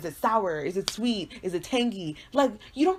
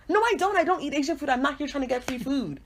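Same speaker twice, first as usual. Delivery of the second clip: high-pitched, indignant protest; indignant retort; 5 semitones higher than usual. Acted. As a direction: push back in an affronted, raised pitch. Indignant retort to imagined waiter.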